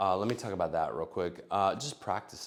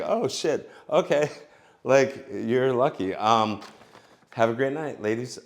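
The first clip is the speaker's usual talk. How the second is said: louder and higher, caught off guard; loud surprised guy; 4 semitones higher, 8 dB louder than usual. Retold or imitated. Guy reacting to being turned down, loud surprise. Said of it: "you're like".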